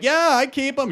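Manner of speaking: high, loud cheery claim; mock eager audience member. Masked audience member claiming to keep cards, mock eager. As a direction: chirp it up high, falsely keen. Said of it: "so you're like".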